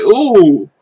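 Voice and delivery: loud, high hooting ooh; impressed ooh. Real, not acted. Reacting to a hard bar with a loud impressed ooh. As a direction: let out a big impressed ooh. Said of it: "I'm like".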